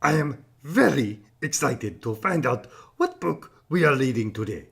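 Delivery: ordinary delivery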